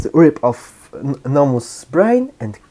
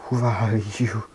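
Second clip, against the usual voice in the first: whispered question; 3 semitones lower, 10 dB quieter than usual. Retold or imitated. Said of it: "And Shigaraki's like".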